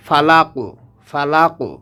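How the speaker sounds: ফালাকু said short, no stretch on লা. Shown as a demonstration. Teacher correcting a common stretching mistake. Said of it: "এখানে টেনে পড়তে হবে না".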